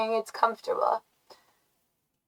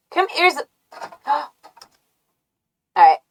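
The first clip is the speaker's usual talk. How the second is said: ordinary talk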